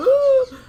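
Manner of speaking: loud, high-pitched mock wail; piercing pretend crying cry; imitated wail. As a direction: burst into a brief fake bawl. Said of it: "you don't see somebody going".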